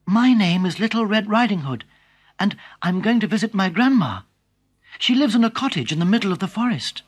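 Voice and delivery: put-on an innocent girl chatting; little girl.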